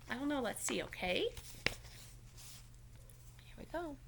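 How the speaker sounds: ordinary delivery, nothing marked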